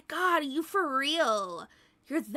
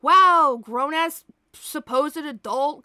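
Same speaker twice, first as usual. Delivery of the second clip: loud scornful wow; mocking wow; 3 semitones higher, 10 dB louder than usual. Real, not acted. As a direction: scoff at the troll. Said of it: "mocking the troll".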